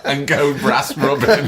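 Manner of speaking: high, slow tease through laughter; laughing tag-on joke. Real, not acted. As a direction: chuckle the words out loudly. Teasing, both laughing.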